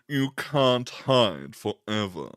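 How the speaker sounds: slow, menacing killer voice; drawn-out creepy villain threat. Pretend.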